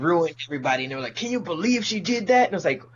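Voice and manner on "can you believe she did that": high scandalized mock voice; mock outrage. Mocking people upset at the halftime show, high and scandalized. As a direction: gasp at it like a gossip.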